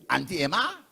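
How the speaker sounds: mock disgusted questioning with a rising end; scornful. Party speaker mimics a rival MP's reaction to Emma, scornful. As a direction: imitate a scornful, incredulous question. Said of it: "Scornful and disgusting. That's how he is".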